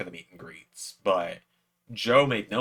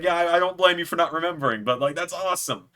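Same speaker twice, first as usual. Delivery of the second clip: excited; 9 semitones higher, 5 dB louder than usual. Retold or imitated. Excited fan meeting his hero.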